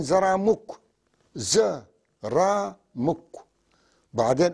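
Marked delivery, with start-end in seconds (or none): 1.3-3.3 "ز، را، مك" said part by part with pauses; split into syllables. Shown as a demonstration. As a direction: chop the word into syllables. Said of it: "عم بقطعوا الكلام". (تقطيع "ز، را، مك" ببطء)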